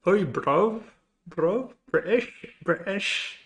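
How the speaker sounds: British accent. Shown as a demonstration. Streamer practising for a viewer, bad British accent. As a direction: try out British slang word by word. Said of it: "in a British accent?".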